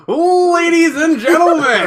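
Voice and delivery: high, loud, stretched ring-announcer call. Pretend. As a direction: boom it like a boxing announcer. Boxing announcer parody.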